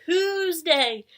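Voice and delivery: loud, high Tuesday; excited answer. Acted. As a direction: cheer the answer. Celebrating the right answer.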